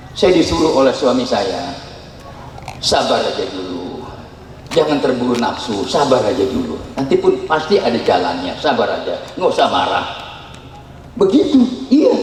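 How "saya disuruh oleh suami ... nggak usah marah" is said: low, soothing husband's counsel; mild calm reassurance; calm advice. Retold or imitated. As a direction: coax patience gently. Wife quoting husband's calm advice.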